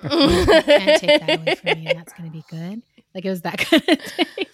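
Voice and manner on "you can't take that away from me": high, loud, laughing through it; laughing defiance. Real, not acted. Laughing loudly to the universe, defiant and gleeful. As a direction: laugh out that nothing can take this away.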